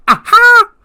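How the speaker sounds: very high, loud triumphant "aha"; shrieked gloating aha; gloating laugh. Retold or imitated. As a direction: crow it out in triumph. Ricochet gloating over rematch.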